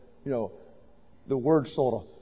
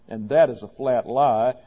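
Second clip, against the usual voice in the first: ordinary talk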